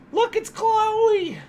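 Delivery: very high, stretched-out singsong; exaggerated high coo showing off a pet; gushing pet squeal. Real, not acted. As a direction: squeal it slowly, drawing out the name. Shows dog again, more gushing.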